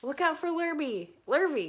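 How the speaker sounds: high, loud cow call. A narrator's reading. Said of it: "called the cows".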